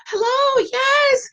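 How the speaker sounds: high, sweet phone greeting; sugary phone voice. Shown as a demonstration. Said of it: "you just put on".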